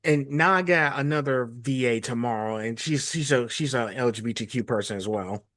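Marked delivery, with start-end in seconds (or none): none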